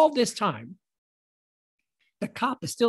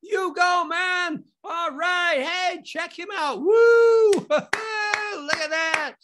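high whooping spectators; cheering crowd yells; 11 semitones higher, 4 dB louder, much slower than usual. Acted. Movie line crowd cheering the passing streaker, yelling. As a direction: hoot and holler in rowdy approval. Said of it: "they started applauding and yelling".

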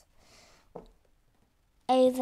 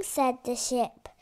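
ordinary delivery, nothing marked

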